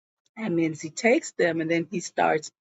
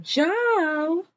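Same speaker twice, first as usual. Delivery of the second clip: calling out; 7 semitones higher than usual. Retold or imitated. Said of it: "And she goes".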